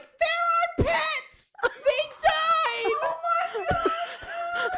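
very high, loud, slow wail; mock wailing shout. Retelling cousins defending dead pets, high wailing shout. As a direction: wail it like grieving kids. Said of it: "And they're like".

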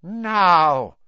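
icy, loud command; cold voice. A narrator's reading. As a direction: bark it out coldly. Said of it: "said the cold voice".

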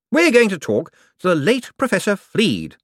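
high, eager, bright-voiced wizard; a jumpy official covering for himself. A narrator's reading. Hicks announcing the plan.

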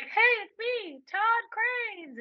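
high prank voice; high teasing voice; mock Todd Cranes. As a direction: play the prank caller. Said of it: "I was like".

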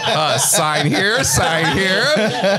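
slow, high, laughing salesman pitch; pushy salesman. Pretend. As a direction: ham up a pushy dealmaker. Playing a salesman closing a deal.

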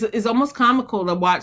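usual speaking voice

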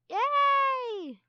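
high, loud, drawn-out cheer; piercing long "yay"; excited battle cry. Acted. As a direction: shout it gleefully as you lunge. Clay shouts lunging into mock battle.

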